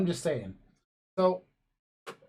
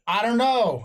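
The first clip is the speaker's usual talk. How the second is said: acted son calling back from another room, higher; raised voice; 6 semitones higher, 4 dB louder than usual. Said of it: "that's why I raised the voice".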